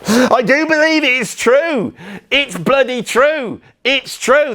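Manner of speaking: high, insistent mock conviction; believing the posh act. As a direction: lean into the character until it sounds sincere. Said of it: "if I start talking like this".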